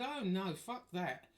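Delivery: loud, high indignant refusal; flat-out refuse, voice up; outraged refusal. Retold or imitated. Refusing the arranged wedding.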